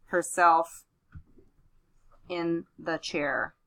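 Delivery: slow, spaced-out dictation; dictating. Shown as a demonstration. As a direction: read it out as you write. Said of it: "So I'm gonna say".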